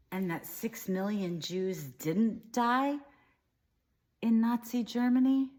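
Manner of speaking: ordinary delivery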